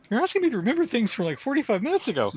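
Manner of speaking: high, fast mocking voice; high mocking quote. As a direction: squeak it out quickly. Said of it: "'cause I said".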